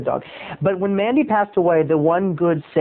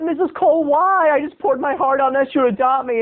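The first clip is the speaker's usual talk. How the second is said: high-pitched, mock-hurt protest; wounded plea; 10 semitones higher than usual. Asking friend's mother why she won't adopt him, mock-wounded plea. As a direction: plead up high, playing the rejected child.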